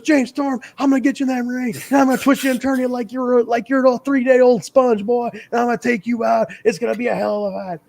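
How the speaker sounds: Southern accent, hammed-up hype promo; Southern wrestling promo. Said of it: "very Southern wrestling promo".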